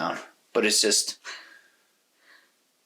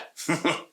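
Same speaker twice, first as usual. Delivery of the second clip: laugh; 9 semitones higher than usual. Real, not acted. Laughs agreeing with co-host.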